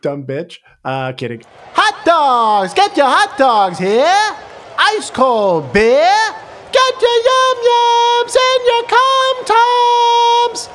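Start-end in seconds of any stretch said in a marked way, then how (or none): 1.7-10.7 loud, high ballpark hawker shout; street vendor calling. Acted. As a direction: bellow it like a stadium vendor, slow.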